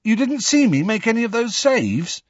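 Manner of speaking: high, rising incredulous question; dismayed disbelief. A narrator's reading. Ron asks friends about his missed saves, crestfallen and incredulous. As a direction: plead in high-pitched disbelief.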